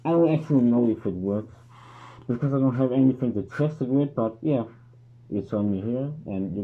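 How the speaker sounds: muffled behind rubber mask; speaking through filter canister; through a gas mask. Real, not acted. Seen 0: Full-face rubber gas mask worn throughout; removed only at 30.7.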